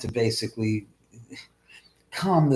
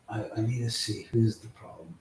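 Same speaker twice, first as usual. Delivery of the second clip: normal talking voice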